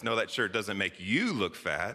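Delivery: single out "you" with a strong accent; pointed emphasis on "you"; stress on YOU. Shown as a demonstration. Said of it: "take it other different ways".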